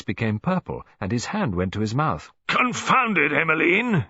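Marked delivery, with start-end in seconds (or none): 2.5-4.0 loud, high, furious roar. A narrator's reading. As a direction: bellow it at his wife across the table. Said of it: "he roared".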